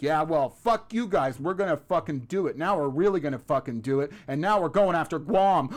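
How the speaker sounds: a petulant dictator hitting back; hammed-up Kim Jong-un threat; mocking Kim. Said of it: "he's gotta respond like".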